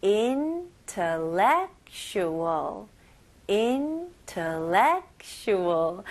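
slow Intellectual, beats on IN and LEC; stress on IN and LEC. Shown as a demonstration. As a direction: drill Intellectual slowly, leaning on IN and LEC. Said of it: "여기 잘 들어보세요".

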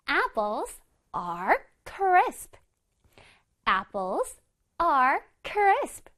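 "Apples are crisp" very slowly, word by word; slow model sentence. Shown as a demonstration. Teacher reading the example sentence slowly to kids. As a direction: spell each word out slowly for the class. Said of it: "자, 그럼 다시 읽어볼게요".

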